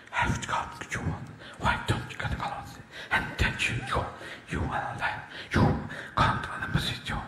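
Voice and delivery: breathy whisper to the horse. Retold or imitated. Horse whisperer whispering orders to the horse. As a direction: whisper the commands. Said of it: "horse whisperers".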